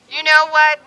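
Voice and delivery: normal talking voice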